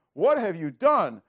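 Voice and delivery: loud, slow, accusing; Samuel's accusing question. Acted. Prophet Samuel rebuking King Saul, loud and slow. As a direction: demand it of Saul, slowly and loud. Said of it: "Samuel says to him".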